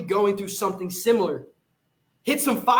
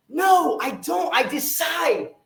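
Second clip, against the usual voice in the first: fiery shout; 7 semitones higher, slower than usual. Real, not acted. Fiery denial after fuck no.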